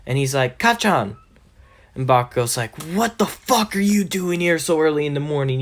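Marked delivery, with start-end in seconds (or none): 0.6-1.1 high, quick call of a friend's name. Acted. Deku spots Bakugo leaving home, high calling out. 2.8-5.6 mock-angry, higher-pitched complaint; irritated bully. Bakugo finds Deku outside at dawn, irritated higher voice.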